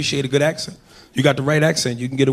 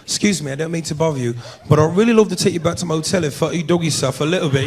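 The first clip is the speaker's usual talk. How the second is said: put-on smooth English accent pickup line; mock English accent. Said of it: "English accent, that just roll off the tongue different".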